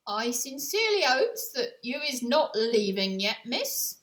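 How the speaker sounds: hopeful shout across the room; George calling out. Acted. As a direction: call after her so she won't go. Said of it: "George called out to her".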